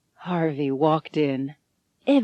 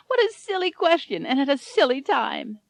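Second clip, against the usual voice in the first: high, light brush-off; high old woman, dismissive; old lady voice; 8 semitones higher than usual. A narrator's reading. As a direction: laugh the question away. Elvira brushes it off.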